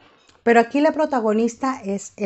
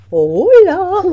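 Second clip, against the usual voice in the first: loud; 9 semitones higher, 8 dB louder than usual. Real, not acted. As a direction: say hello out loud. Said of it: "Di, habla fuerte".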